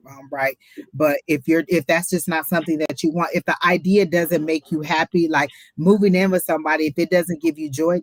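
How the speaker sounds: ordinary talk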